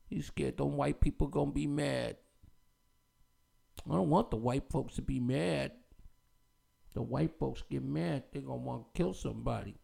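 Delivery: low, quiet, put-on mocking voice of a frightened man; mock scared guest. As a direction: sneer it as a cowering pundit, low and hushed.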